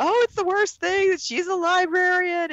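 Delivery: mocking outrage. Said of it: "everybody's going on and on about".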